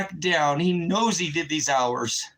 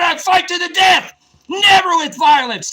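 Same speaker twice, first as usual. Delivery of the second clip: much higher and louder, a roaring vow; defiant shout; 12 semitones higher, 10 dB louder than usual. Retold or imitated. Veteran retelling his vow to viewers, roaring. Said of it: "I said".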